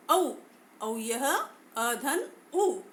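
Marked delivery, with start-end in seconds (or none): 1.8-2.8 अ धन उ said slowly and deliberately; slow explanation. Shown as a demonstration. Teacher slowly shows how औ is formed. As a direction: spell out अ धन उ slowly. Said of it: "अ और उ को मिलाकर".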